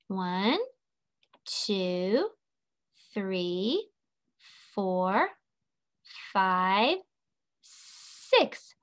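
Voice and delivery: slow count, every number lifting at the end; counting along. Shown as a demonstration. Teacher counting as she draws, slow rising count. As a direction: leave a pause after each rising number.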